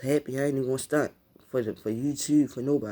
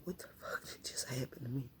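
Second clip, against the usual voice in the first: whispered; 13 dB quieter than usual. Retold or imitated. Retold crying question.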